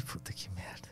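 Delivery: whispered dismay under breath; muttered whisper. Retold or imitated. Traveler fearing the soldiers, whispered curse. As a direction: hush it to yourself, defeated.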